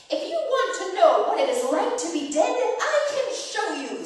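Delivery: high, loud, menacing villain voice, slowing at the end; evil sister. Acted. Aunt voicing the evil sister threatening Ishtar, menacing. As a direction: threaten like a wicked queen. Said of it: "said the evil sister".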